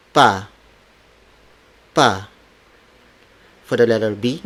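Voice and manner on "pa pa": "Pa" with no puff of air after P; unaspirated P. Shown as a demonstration. Teacher shows learners the stop, "Pa" without aspiration. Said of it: "these consonants are unaspirated".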